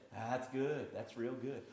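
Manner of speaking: low, soft words of approval; quiet encouragement. Acted. Pastor encouraging a smoker who is cutting down, quiet encouragement. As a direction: encourage softly, voice dropped low.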